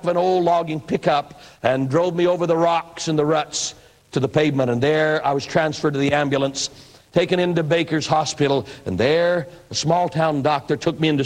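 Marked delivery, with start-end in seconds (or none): none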